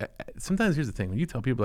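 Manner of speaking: ordinary talk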